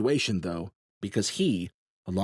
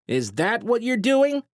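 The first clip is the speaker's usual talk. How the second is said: higher, slow, nasal challenge; 14 semitones higher, 5 dB louder than usual. A narrator's reading. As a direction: needle him in a nasal sneer. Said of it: "his nasally voice not as strong".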